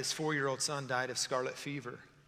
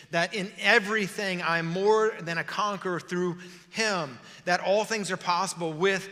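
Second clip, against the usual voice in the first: loud, raised declaration; impassioned preaching; 4 semitones higher, 6 dB louder than usual. Acted. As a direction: preach it to yourself with mounting conviction. Preaches to himself at full force.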